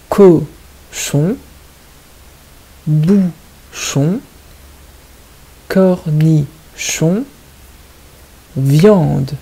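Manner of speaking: "Cochon", "bouchon", "cornichon" split into beats, "viande" drawn out; syllable by syllable. Shown as a demonstration. Teacher dictating the puzzle words, slowly syllable by syllable. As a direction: stretch every syllable, with "chon" set apart. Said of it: "il faut bien écouter la deuxième syllabe".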